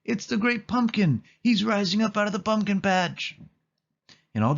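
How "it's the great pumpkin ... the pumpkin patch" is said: high, thrilled cry; boy seeing his hero rise; ecstatic. A narrator's reading. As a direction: shout with wonder. Linus sees Great Pumpkin.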